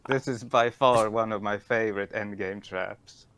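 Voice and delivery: loud, offended snap; indignant shout. Acted. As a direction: bark it back, offended. Indignant outburst at being corrected.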